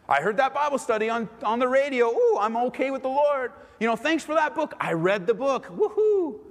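hammed-up pious enthusiasm; mock self-congratulation. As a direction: cheer yourself on, building to a high woohoo. Acts out self-deceived, self-satisfied churchgoer.

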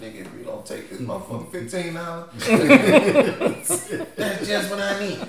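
laughing, high and scratchy; grateful hustler taking the deal; crackhead voice. Retold or imitated. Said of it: "they got a certain tone and pitch".

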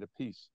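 [name] said as "a piece"; two syllables in [name], stress on PIECE; alternative pronunciation. Retold or imitated. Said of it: "how do you want me to pronounce your name".

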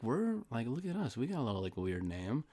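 soft, hushed smug voice; a lightly mocked smug whisper about a quirky name; mock hushed boast. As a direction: say it like a tiny proud secret.

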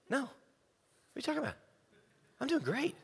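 ordinary talk

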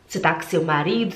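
normal talking voice

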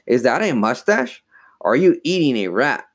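mildly nasty dummy's jeer; a touch of mean mockery. A narrator's reading. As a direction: needle him with rude questions. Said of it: "he asked nastily".

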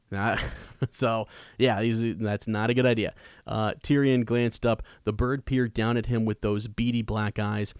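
ordinary delivery

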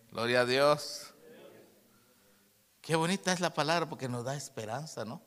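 ordinary delivery

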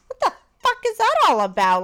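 high, loud mock-baffled outburst; baffled alarm. Retold alarmed thought after gagging.

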